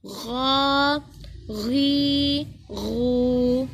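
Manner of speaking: गौ, गी, गू each with a throaty gh and long vowel; ghain from throat. Shown as a demonstration. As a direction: rasp the gh in गौ, गी, गू, hold each. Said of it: "गाइन की आवाज़ निकाल के". (गौ, गी, गू को गैन की आवाज़ में पढ़ें)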